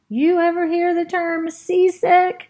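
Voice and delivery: high, loud, slowed parrot complaint; parrot squawk. Acted. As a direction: squawk it out, seasick and annoyed. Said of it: "I say to the squirrel".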